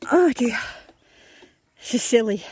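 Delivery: a little winded after mowing; out of breath. Real, not acted. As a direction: speak slightly out of breath. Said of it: "I'm out of breath".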